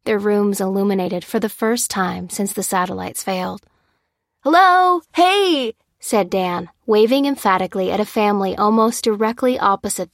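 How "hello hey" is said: high, loud joyful greeting; excited calling out. Acted. Dan waving at the family opposite, calling out excitedly. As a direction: holler hello to get their attention.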